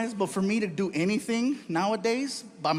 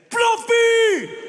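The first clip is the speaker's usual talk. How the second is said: much higher, much louder yell of recognition; excited shout; 11 dB louder than usual. Retold or imitated. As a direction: greet a star at full volume. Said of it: "He looked at me and said".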